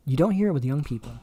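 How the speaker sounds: ordinary talk, nothing marked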